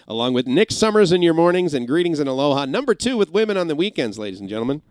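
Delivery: mock his own old on-air persona; cheesy DJ voice. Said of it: "I didn't actually talk like that".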